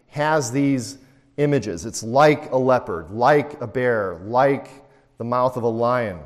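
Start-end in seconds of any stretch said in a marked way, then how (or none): 1.9-4.7 "like" punched and falling each time; stress on like. Shown as a demonstration. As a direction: lean on "like" in every phrase. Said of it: "notice that word "like" three times".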